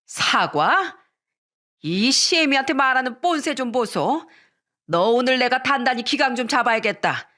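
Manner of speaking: lightly voiced affronted mother-in-law; indignant mother-in-law. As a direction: put the insolent daughter-in-law in her place. Mother-in-law takes offence.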